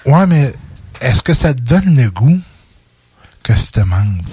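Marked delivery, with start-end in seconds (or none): 3.4-4.2 breathy teasing whisper. Acted. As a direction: whisper it seductively. Teasing seductive line, whispered.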